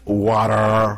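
"Water." in a mocking put-on accent; a light mocking imitation of "Water." in the cashier's accent. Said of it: "she's making fun of me".

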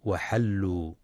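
وحلوا with open a on ح, marked wrong; faulty وحلوا, ha with a; wrong reading. Shown as a demonstration. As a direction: show the mistake وحلوا with a. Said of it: "فلا يقرأ".

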